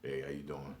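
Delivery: soft, low, innocent hello; quiet casual hello; polite greeting. Retold or imitated. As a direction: play it cool and polite. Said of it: "And we sitting there".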